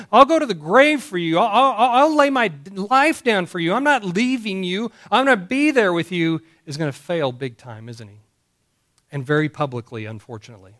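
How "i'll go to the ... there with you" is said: higher, eager boast of loyalty; eager Peter. Retold or imitated. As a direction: play Peter's rash promise, pitched up. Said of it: "this guy was so quick to say".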